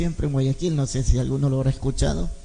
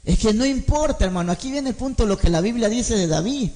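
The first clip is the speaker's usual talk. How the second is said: preaching at full force; 8 semitones higher than usual. Acted. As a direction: preach it out, rising and ringing. Predica a toda voz. (predicación encendida, a toda voz y cantada)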